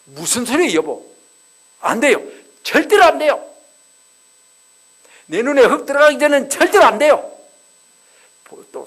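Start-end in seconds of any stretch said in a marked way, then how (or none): 0.0-7.3 high, loud alarmed protest; shocked wife loudly refusing her husband; shocked refusal. Retold or imitated. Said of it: "사라가 깜짝 놀라면서".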